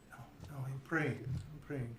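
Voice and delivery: softly acted tearful praying; a touch of tears, hushed at first; crying. Irina caught praying for her father, mildly crying. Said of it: "She's, like, crying".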